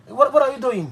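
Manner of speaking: high, indignant what are you doing; mock outrage. Young lodger pushing away the landlady's hand, mock outraged. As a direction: act shocked at her hand. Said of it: "Il dit".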